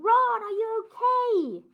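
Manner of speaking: high, slow call through rock; shouting. Acted. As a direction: call out to a friend. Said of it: "he's shouting".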